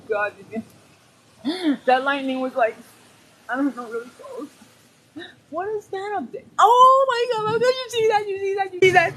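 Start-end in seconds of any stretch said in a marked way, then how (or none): 5.5-9.1 high, loud tearful yells; real panicked near-sobbing shouts; panicked crying. Real, not acted. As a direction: cry out in panic at the sky.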